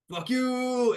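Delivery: high, loud drawn-out call; shouted send-off. Acted. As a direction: holler it after departing comrades. Crew shouts farewell at departing party.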